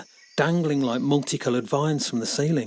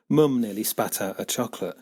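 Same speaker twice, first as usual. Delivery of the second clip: ordinary delivery, nothing marked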